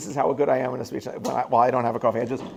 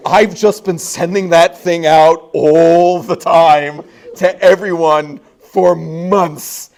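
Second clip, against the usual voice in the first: aghast; 4 semitones higher, 11 dB louder, much slower than usual. Retold or imitated. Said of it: "He's like".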